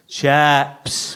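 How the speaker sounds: "Chips" drawn out at normal pitch; a second, level attempt at "Chips"; different intonation. Shown as a demonstration. Poet repeats the order in a London pub, different intonation on "Chips". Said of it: "with a different intonation on each occasion".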